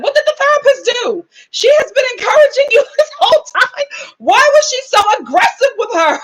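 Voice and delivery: high, loud, laughing disbelief; incredulous outburst. Retold or imitated. Outraged reaction, laughing.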